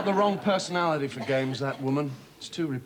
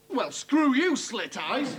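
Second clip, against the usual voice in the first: angry trader; 6 semitones higher, slower than usual. Acted. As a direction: snap it at the rival. Trader cursing a counterpart.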